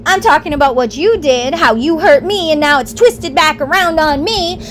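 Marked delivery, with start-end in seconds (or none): none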